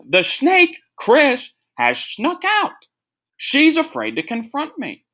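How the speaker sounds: very high, slow, dramatic voice; Bruce Jenner impression. Podcaster repeating his favourite Bruce Jenner line, very high and slow. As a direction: pitch way up and drag out the accusation. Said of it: "I just like when he says".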